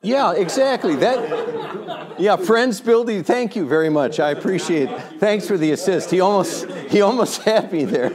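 high, loud, laughing comeback; amused banter. Real, not acted. Speaker thanking his heckling friend for the assist, laughing banter. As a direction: fire back loudly through your laughter.

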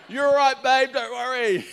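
high, loud mock reassurance; hammed-up reassurance. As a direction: call it out to a worried wife, overdoing it. Acts out reassuring his wife.

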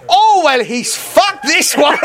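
high, loud imitated heckle from offstage; Alistair Cunningham. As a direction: crow it out over a silent room. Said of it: "hear him just go".

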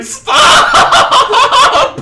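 very high, loud laughing shout. Acted. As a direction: yell at him to stop. Player character shouts to stop.

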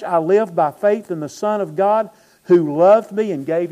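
loud preaching from the pulpit; full-force preaching. Acted. Pastor to congregation, booming sermon delivery.

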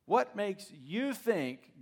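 What makes you think, slow, with What stressed and falling; slow mocking echo of the question; incredulous repeat. Shown as a demonstration. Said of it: "What a question".

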